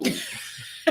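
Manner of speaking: a high whispered ooh, impressed; mock surprised ooh. Retelling reply to the cashier's joke, hushed high surprised ooh. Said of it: "I was like".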